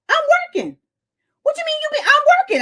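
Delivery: high indignant complaint; exasperated high rant. Retold or imitated. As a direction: sputter it out, incredulous. Ranting at delivery man, high.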